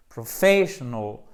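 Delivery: slow PROFESSIONAL with a bold stress on FE; don't be shy, stretch FESS in "Professional"; accent appuyé. Shown as a demonstration. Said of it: "Il faut pas hésiter". (Professional, accent tonique franc sur FE)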